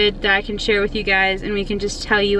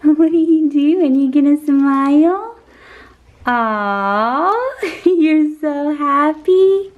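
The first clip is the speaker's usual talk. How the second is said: high, slow, loud cooing with laughs; 4 semitones higher, 4 dB louder, much slower than usual. Real, not acted. Mom gushing at her smiling son, slow high baby talk.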